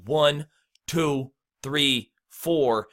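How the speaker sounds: measured count with a gap after each number; counting on the beat. Shown as a demonstration. Coach shows learners how to count out the beat, evenly spaced. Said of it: "when you count out the beat".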